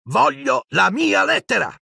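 put-on boy's desperate shout; shouted demand. A narrator's reading. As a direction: yell for what's yours. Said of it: "gridò".

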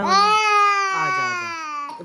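high drawn-out come-here call; long sing-song coaxing call. Real, not acted. Calling the baby to come.

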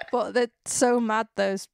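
usual speaking voice

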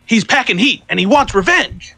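high, theatrical announcement voice; mock-dramatic high-pitched proclamation; quoted reference. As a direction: deliver the quote high and hammed-up. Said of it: "I have a reference I wanna make".

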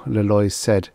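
ordinary delivery, nothing marked

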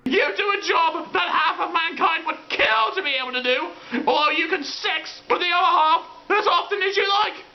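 shouted, exasperated tirade; comic screaming rage; angry rant. Acted. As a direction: harangue him at the top of your voice. Acted quarrel with the creator.

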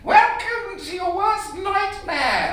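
very high pantomime witch voice; hammed-up witch inviting the visitors in. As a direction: squeal it out like a storybook witch at her door. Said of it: "when you can do a witch voice like I can do a witch voice".

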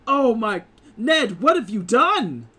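high, loud, flustered frog man cry; a startled frog man scolding his duck friend; frog man dismay. Acted. Frog man catching sight of the fallen intruders, loud and high. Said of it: "who looks and says".

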